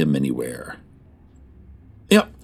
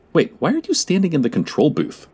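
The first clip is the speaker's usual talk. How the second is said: ordinary delivery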